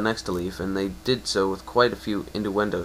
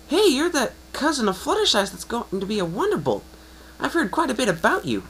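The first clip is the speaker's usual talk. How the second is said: high, bold, cocky pony voice; eager sporty mare. Acted. Brash pony sizing up the newcomer, high and cocky. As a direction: voice a brash, competitive young mare, pitched well up. Rainbow Dash greets the cousin.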